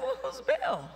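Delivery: high, loud baffled question; squeaky high "who's Bill?"; puzzled. Retold or imitated. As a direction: ask it in real confusion. Confused about who Bill is.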